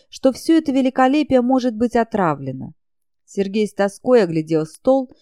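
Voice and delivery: plain reading voice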